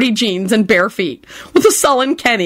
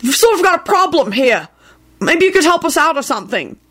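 cross; 9 semitones higher than usual. Acted.

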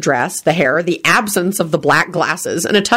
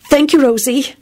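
mildly nervous, higher voice; nervous reply; 7 semitones higher than usual. A narrator's reading. Beatrice answers Rosie's compliment, a touch nervous. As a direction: thank her nervously. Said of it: "Beatrice replied nervously".

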